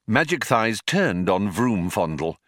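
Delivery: ordinary delivery, nothing marked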